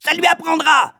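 very high gloating screech; shrieking. A narrator's reading. Woman gloating over the caned boy, shrieking. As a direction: screech it with vindictive glee. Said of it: "hurla madame Pratchett".

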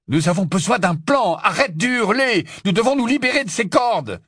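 high, loud, exasperated voice; urgent shouted orders. A narrator's reading. Harry pleading for a plan to escape, urgent shout. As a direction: shout sense into a panicking friend.

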